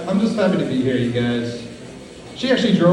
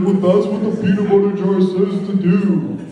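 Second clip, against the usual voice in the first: put-on girlfriend voice; 4 semitones higher, 4 dB louder than usual. Girlfriend quoted in story.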